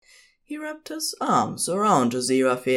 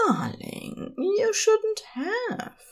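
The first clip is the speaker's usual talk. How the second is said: much higher, slow, gentle partner voice; fond partner voice; slower than usual. A narrator's reading. Aziraphale welcoming Crowley home, high slow gentle voice.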